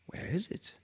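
soft high whisper. A narrator's reading. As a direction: whisper it to yourself, puzzled.

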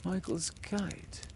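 slow, soft, listless; without interest. A narrator's reading. Exhausted Peter noticing the kite, without interest. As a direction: say it with no interest at all. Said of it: "Peter said without interest".

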